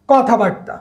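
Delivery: ordinary talk, nothing marked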